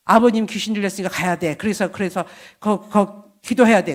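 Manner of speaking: urgent insistence. Retold or imitated. Urging husband, emphatic.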